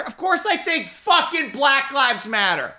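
high, slow, exasperated retort; indignant outburst. Real, not acted. To an imagined accuser, an exasperated high outburst. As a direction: throw the answer back, fed up.

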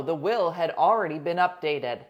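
ordinary talk

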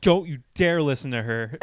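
loud mock warning from a pushy stranger; aggressive, imitated. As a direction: mimic someone butting in forcefully, volume up. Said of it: "aggressively, like".

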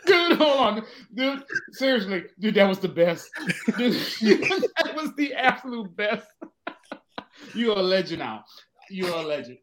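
fighting through tears of laughter; laughing, teary. Real, not acted. Still cracking up.

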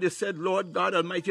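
ordinary talk

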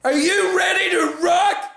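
overexcited stage shout, slow on the last line; hyped rock shout. Retold or imitated. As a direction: shout it like a rock frontman to a dead room. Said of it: "you walk on just being like".

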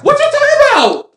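high-pitched indignant squeal; indignant disbelief. Real, not acted. Heated argument over the accent.

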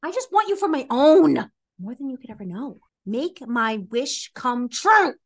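usual speaking voice

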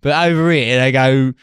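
मज़ाक में ऊँची आवाज़ वाला ऑस्ट्रेलियाई कट्टर; mock Australian bigot. Continues mock Australian rant.

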